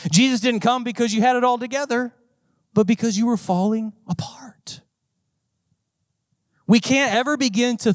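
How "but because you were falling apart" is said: whispered. Real, not acted. Pastor whispering to the congregation about falling apart. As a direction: drop to a whisper for the broken listener.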